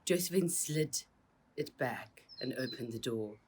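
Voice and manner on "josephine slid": ordinary delivery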